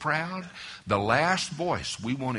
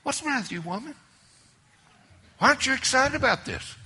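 lightly retold scolding, pitched much higher, fast end; mock exasperated husband; 14 semitones higher, 5 dB louder, much faster than usual. Preacher imitating himself scolding his wife, mild. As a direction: demand it like an impatient husband.

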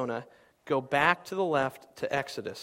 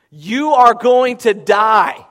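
slow, loud, much higher doom verdict; stark proclamation; 8 semitones higher, 17 dB louder, much slower than usual. Acted. Jonah's blunt warning to Nineveh, proclaimed slowly and loudly. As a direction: deliver the death sentence slowly and loudly.

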